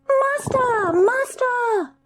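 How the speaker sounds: very high thin calling voice; high singing call of a harp; magic harp calling. A narrator's reading. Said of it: "the harp called out".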